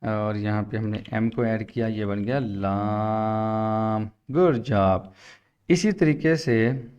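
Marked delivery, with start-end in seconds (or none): 1.9-4.1 very slow, stretched words; slow, stretched teacher reveal; drawn-out teaching. Shown as a demonstration. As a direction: stretch it out for the child. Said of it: "ये अलग किया".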